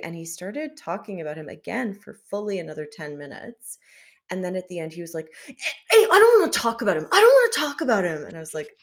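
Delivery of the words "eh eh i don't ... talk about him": loud, high mock outburst; hammed-up exasperated whine; retold agitated protest. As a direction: imitate someone worked up and refusing loudly. Retold agitated refusal by the date.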